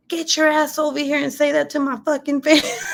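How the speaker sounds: high, laughing dare; laughing mock challenge. To the fleeing jogger, a high laughing dare. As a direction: yell the dare but crack up through it.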